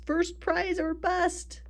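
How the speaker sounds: slow, high proclaiming voice; slogan read-out. Acted. Reading the sign on the giant pumpkin, proclaiming. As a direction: draw it out like a rallying cry.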